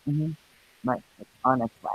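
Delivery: usual speaking voice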